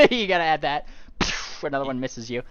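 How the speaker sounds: normal talking voice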